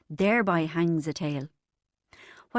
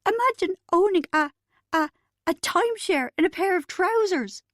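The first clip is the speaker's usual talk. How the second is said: much higher, louder, stammering; barely able to speak for laughing; 11 semitones higher, 4 dB louder than usual. Acted. Bridget grabbing the narrator's arm, breaking up with laughter. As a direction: squeeze out the words between laughs. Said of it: "almost unable to speak".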